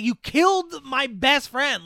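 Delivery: mock-dramatic grief-stricken fury; vengeful anger. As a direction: accuse the killer of your friend. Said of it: "anger and vengeance".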